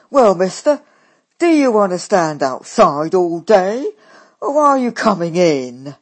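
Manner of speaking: loud, blunt call to come in; loud impatient sister; impatient brash sister. A narrator's reading. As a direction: hurry the man in, loudly. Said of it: "Daisy impatient at door".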